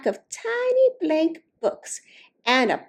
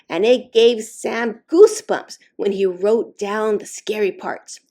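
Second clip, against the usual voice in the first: ordinary delivery, nothing marked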